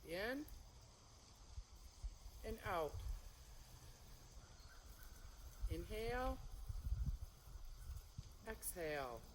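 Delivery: unhurried cue spaced with long pauses; serene tai chi cues. Shown as a demonstration. Paced breathing cues during form.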